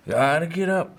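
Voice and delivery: mock-urgent plea; imitated insistent son. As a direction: imitate a patient who keeps insisting. Retelling sedated son's urgent insistence.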